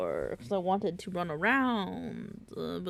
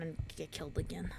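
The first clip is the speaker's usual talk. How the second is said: whisper; 2 semitones lower, 8 dB quieter, faster than usual. Real, not acted. Quips while dead in game.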